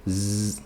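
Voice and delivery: buzzing Z on its own; Z vozeado. Shown as a demonstration. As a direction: test Z for vibration, holding it out. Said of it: "Não, ela vibra". (Z sustentado com vibração)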